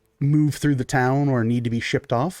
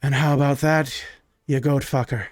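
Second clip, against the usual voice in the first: put-on smug drunk. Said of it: "slowly pulls the dagger out and goes".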